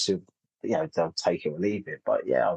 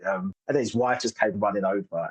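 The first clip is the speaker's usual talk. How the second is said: ordinary delivery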